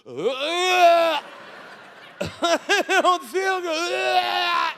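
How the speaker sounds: high, very slow whimpering wail; sick wail. Retold or imitated. Comedian imitating seasick wife, hammed-up whimper. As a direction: stretch it out like a miserable child. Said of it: "she went from having fun to".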